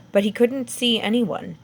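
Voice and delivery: ordinary delivery, nothing marked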